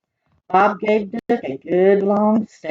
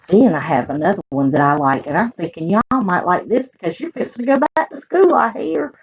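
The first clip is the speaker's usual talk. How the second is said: ordinary delivery, nothing marked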